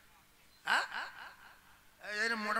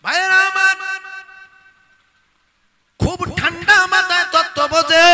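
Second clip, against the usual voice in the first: rallying preacher's shout; 9 semitones higher, 20 dB louder than usual. Real, not acted. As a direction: fire up the crowd at full volume. Rallies the crowd with takbir.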